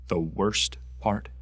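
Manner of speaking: reining the anger in, slow and deliberate; grim repeat. Acted. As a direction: draw it out heavily after the outburst. Acted monologue, grim repeat after outburst.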